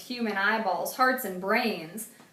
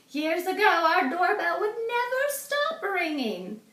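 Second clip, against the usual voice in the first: put-on old woman voice; 9 semitones higher than usual. A narrator's reading. Mrs Tibble welcomes Arthur warmly, high old-lady voice. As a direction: offer it sweetly like a lonely grandmother.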